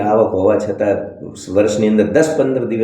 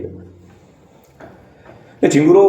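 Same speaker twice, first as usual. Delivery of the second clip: drawn-out mocking jeer; 6 semitones higher, much slower than usual. Mocking insult list.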